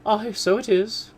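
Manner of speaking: high, loud slow cry; mother crying out; cried out. Acted. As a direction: exclaim in sudden triumph. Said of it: "cried her mother".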